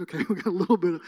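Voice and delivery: quick words breaking into laughter; laughing aside. Real, not acted. Preacher joking to congregation, laughing. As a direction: laugh it off to the room.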